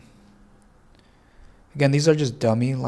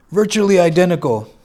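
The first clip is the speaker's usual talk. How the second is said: loud quoted catchphrase; 6 semitones higher, 9 dB louder than usual. Retold or imitated. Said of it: "What did that fool used to say?".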